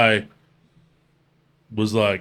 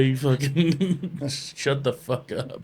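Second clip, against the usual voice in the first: laughing snap; 4 semitones higher, 3 dB quieter, much slower than usual. Retold or imitated. Retelling annoyed at questions.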